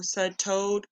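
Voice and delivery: plain reading voice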